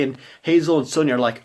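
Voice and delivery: ordinary delivery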